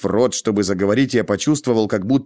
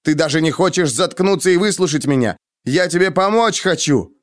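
higher-pitched heated protest; exasperated; 6 semitones higher than usual. A narrator's reading. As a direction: plead angrily to be heard. He argues back angrily. (раздражённо, с напором)

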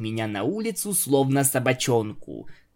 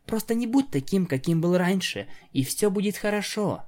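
female pony voice; 7 semitones higher than usual. Acted. Octavia reassuring.

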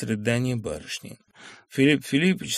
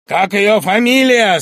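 louder, slow, pressing question; stern question; 10 semitones higher, 13 dB louder, slower than usual. Acted. Bormenthal questioning Sharikov, stern and pressing. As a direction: demand it coldly. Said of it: "спросил у него Барменталь".

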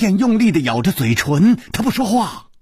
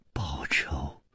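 low, whispered echo; hushed disbelief; 13 semitones lower, 15 dB quieter than usual. Acted. Stunned woman echoing her companion's vow of revenge, hushed. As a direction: echo it barely audibly.

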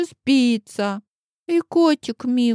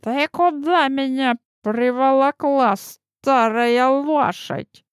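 сварливая старуха; 2 semitones higher than usual. Acted.

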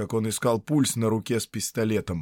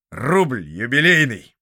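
smirking; 3 semitones higher, 8 dB louder, slower than usual. Acted. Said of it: "усмехнулся Митишатьев".

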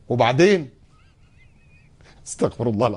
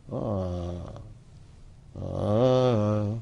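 reluctant sigh; 4 semitones lower, 6 dB quieter, much slower than usual. Retold or imitated.

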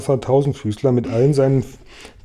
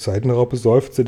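usual speaking voice

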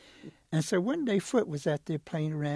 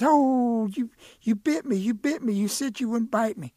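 pained outcry; 6 semitones higher, 7 dB louder than usual. Acted. Boy bitten by rattlesnake.

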